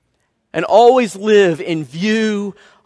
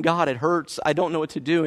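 weary plea; 2 semitones lower, 7 dB quieter than usual. Acted. Weary runner crying out to God.

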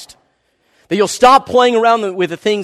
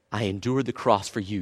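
much softer and lower, gentle; tender reassurance; 9 semitones lower, 10 dB quieter than usual. Acted. God answering a hurting runner, soft and tender. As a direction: comfort them close and quietly.

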